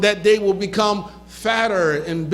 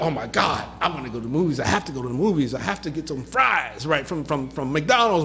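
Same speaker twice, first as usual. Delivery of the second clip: lower, softer whiny mock of desperate people; mocking moviegoers; 5 semitones lower, 4 dB quieter than usual. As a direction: sneer at people who can't live without movies and fries. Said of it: "a lot of people are like".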